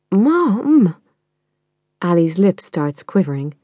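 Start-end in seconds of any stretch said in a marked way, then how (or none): 0.1-1.0 high wailing Mom; protest, close to tears; upset teen protest. Acted.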